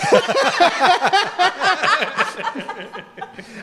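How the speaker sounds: herzhaftes, hohes Lachen; laut loslachen; lautes Lachen. Real, not acted. Lacht über eigenen Witz. (herzhaftes Lachen)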